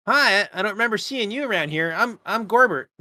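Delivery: character voice. Acted. Gorbert greets a stranger.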